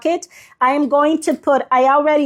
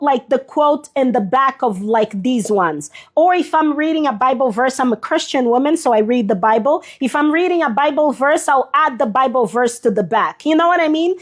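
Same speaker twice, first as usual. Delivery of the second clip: ordinary talk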